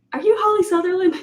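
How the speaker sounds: high, eager fan asking; a fan who can't believe it's her; excited fan. Retold or imitated. Stranger in the hallway greets her eagerly.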